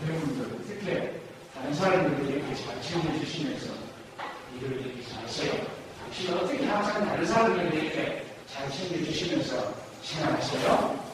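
soft, low, gentle compliment; quiet appreciation, modelled for the class; admiring praise. Shown as a demonstration. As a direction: praise them tenderly, voice dropped and soft. Models praising remarks instead.

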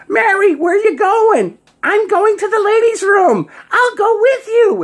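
very high, loud falsetto women; mock girly chatter. Girls at a bar table calling to Mary, mock girly chatter. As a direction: squeal it out in a put-on female voice. Girls announce a ladies' room trip.

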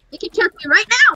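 shouting. Real, not acted. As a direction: yell it back defensively. Defending against theft accusation.

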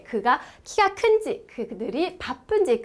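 usual speaking voice

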